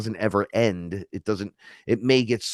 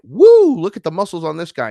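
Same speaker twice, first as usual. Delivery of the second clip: much higher, much louder whoop then fast; excited whoop; 8 semitones higher, 10 dB louder than usual. Retold or imitated. Slapping the giant's back, a loud high excited whoop.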